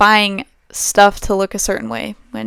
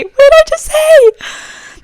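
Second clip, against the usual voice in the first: very high, loud, drawn-out squeal; incredulous squeal; 11 dB louder, slower than usual. Real, not acted. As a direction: shriek it slowly in sheer disbelief. Escalating disbelief at her slip.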